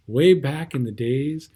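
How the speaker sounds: plain reading voice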